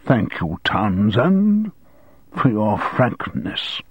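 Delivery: headmaster struggling to stay polite; strained courtesy, dragged out; barely managed composure. A narrator's reading. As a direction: force out the thanks, slowing at the end. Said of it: "the headmaster somehow managed".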